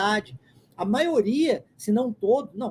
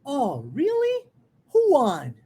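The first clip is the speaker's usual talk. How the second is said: sing-song rise on "really", fall on "won"; marked intonation; 3 semitones higher, much slower than usual. Shown as a demonstration. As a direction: stretch "really" upward, then let "won" drop. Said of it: "listen and repeat".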